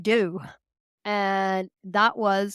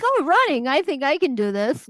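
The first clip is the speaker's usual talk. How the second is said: higher, louder burst of naive optimism; naive eager self-quote; 5 semitones higher, 4 dB louder than usual. Retold or imitated. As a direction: imitate her past self's bright eagerness at the idea of running. Naive past self, quoted to herself.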